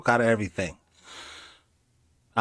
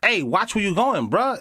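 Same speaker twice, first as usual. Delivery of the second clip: street guy voiceover; 9 semitones higher than usual. Acted. Man warning someone to watch where he's going, comic street voice. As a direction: dub in a guy calling out on the street. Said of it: "Hey, the dude".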